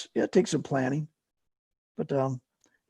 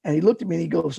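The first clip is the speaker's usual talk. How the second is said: normal talking voice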